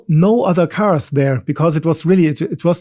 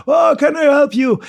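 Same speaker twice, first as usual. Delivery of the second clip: cheery helper's call; 10 semitones higher than usual. Retold or imitated. The rescuers' offer of help, retold.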